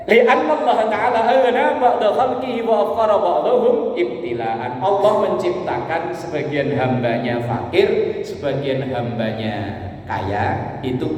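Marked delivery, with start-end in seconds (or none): 0.1-4.8 higher, louder chanted Arabic; Arabic recitation. Acted. Preacher reciting a scripture quote to the congregation, chanted. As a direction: intone it like a recited text.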